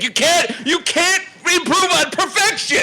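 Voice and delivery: high-pitched theatrical indignation; hammed-up offended fan, laughing edge; mock outrage. Mock outrage over favorite film.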